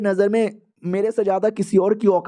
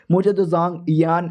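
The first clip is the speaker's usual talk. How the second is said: plain reading voice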